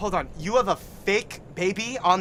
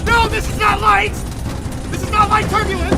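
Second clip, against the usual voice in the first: loud, high, panicked cries; 10 semitones higher, 6 dB louder than usual. Acted. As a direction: panic at the shaking plane. Panicking in turbulence.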